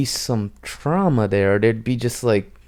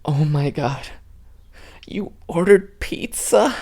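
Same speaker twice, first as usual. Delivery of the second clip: slow, high, overdone sentimental gasp; mock sappy gush; 5 semitones higher than usual. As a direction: ham up the sister's cheesy awe. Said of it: "she's like ... cheesy, sappy moment".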